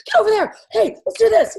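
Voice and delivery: mock-bossy shouted orders on set; bossy calling out. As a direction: herd people around like an overexcited director. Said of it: "I'm not allowed to tell everyone where to go".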